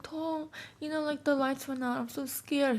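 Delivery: high, soft, mock-frightened voice; mock scared. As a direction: play the scared little one in the dark. Waking roommate in blackout, scared.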